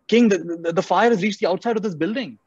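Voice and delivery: high, stammering alarm; mock-frantic messenger to the king; panicking guard. Guard's alarm grows as fire nears.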